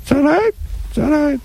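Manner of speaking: very high squeaky puppet voice; ferret voice. Pretend. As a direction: squeak it like a tiny ventriloquist dummy. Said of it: "You know what the vagina sounds like".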